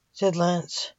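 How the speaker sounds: plain reading voice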